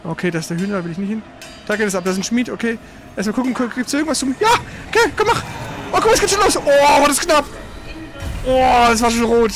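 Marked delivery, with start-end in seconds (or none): none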